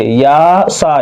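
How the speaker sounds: या सा with both vowels held long; careful model reading, या and सा drawn out; long aa on each syllable; properly stretched vowels. Shown as a demonstration. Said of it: "सही से समझिए".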